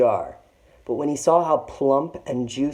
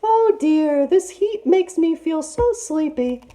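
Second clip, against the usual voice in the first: sleepy fake auntie; 4 dB louder than usual. A narrator's reading. Disguised tiger voice.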